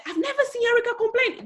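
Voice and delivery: high, quick quoted voice; Katrina. Retold or imitated. Quoting a woman praising her before others, high imitation. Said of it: "There was Katrina one day, she said".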